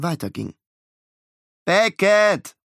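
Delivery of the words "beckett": higher, louder call across the beach. Acted. Miles calling his brother on the rocks, loud call. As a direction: call him over. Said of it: "rief er".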